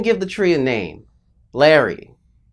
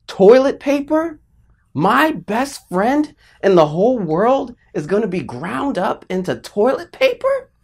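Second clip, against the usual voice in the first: high, loud hammed-up horror; traumatized outrage; 8 semitones higher, 5 dB louder than usual. Child wailing over best friend's fate, acted traumatized outrage. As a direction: build a child's outraged disbelief, pitched high. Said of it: "I was traumatized".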